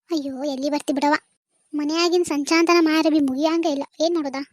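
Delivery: low-pitched, put-on complaint; lamenting neighbour. Neighbour explaining housework keeps her away, low lament.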